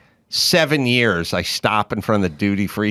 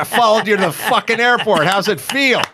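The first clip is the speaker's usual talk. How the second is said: higher, gloating fan voice taunting back; mock creepy stalker; 10 semitones higher than usual. Stalker fan taunting Greg at the airport, gloating. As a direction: gloat like you tracked him down.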